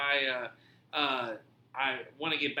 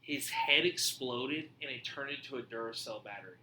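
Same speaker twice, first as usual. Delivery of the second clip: ordinary delivery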